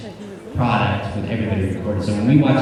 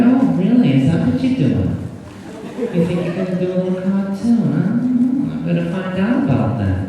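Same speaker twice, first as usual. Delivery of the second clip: imitating grandmother; 6 semitones higher than usual. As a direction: tease like a suspicious old nonna. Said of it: "who talks a little bit like this".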